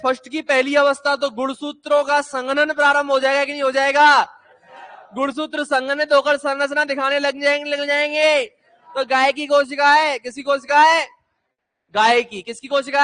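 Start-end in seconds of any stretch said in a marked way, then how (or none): none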